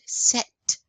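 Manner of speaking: "Sept" with the T sounded; pronounced final T. Shown as a demonstration. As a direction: say "Sept" as "set". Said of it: "we are pronouncing that T at the end".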